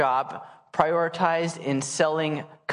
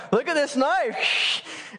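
high, slow, drawn-out wonder; showy sales demo; 7 semitones higher, much slower than usual. Retold or imitated. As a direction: show off the product like a hammy pitchman. Acting the knife demonstration.

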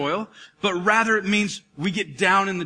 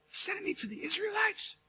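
much higher, much softer disbelief; incredulous; 12 semitones higher, 11 dB quieter than usual. Pretend. Ezekiel asking about the Israelites, stunned and incredulous. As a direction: ask it in stunned wonder. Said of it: "disbelieving prophet".

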